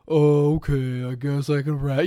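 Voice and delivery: a hint of a dopey guy voice, loud on Oh; lightly mock a slow-witted man; wife imitating a guy. Wife mocking the contractor, mild dopey guy voice. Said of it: "every time a woman does an impersonation of a guy".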